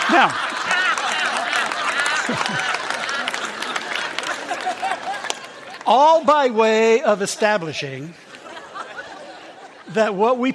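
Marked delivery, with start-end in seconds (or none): 0.4-5.5 long, high, breathless laugh. Real, not acted. Preacher laughing at own joke. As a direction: crack up at the punchline. Laughter continues after punchline.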